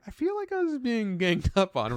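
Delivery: high, put-upon whine; mock Dave complaining he's picked on; whiny Dave. Imitating Dave whining about being ganged up on. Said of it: "Dave's just like".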